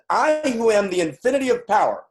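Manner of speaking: voice of God. Acted. God speaks to humanity, booming proclamation. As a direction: proclaim it like God declaring his power. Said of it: "So God says".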